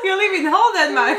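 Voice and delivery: giggling while teasing; laughing. Real, not acted. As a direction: laugh through the words. Laughing tease at partner.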